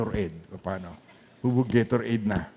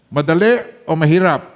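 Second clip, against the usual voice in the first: louder question; 3 semitones higher, 7 dB louder than usual. Real, not acted. Pastor presses the congregation for an answer, louder. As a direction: ask "Madali o mahirap?" louder, pressing the crowd for an answer. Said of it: "Tinatanong ko kayo eh".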